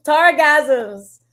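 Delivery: repeating the word through a laugh, pitch up. Real, not acted. Streamer repeating a chat pun, laughing. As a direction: crack up while saying the word again.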